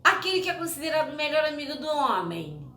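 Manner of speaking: high, fast eager reveal; excited reveal. Real, not acted. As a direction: race to the answer with glee. Revealing quiz answers excitedly. (revelação animada e aguda)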